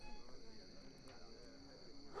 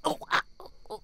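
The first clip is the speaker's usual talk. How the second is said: ordinary delivery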